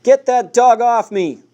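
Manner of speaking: high, loud, slow panicked cry; panicked scream. A narrator's reading. Bitten man begs for help, panicked scream. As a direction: scream for help under attack.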